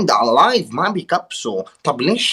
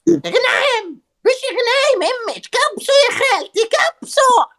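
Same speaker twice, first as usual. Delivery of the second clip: put-on very high old woman voice. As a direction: pitch way up like an old granny. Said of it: "العجوز لازم صوت بروح".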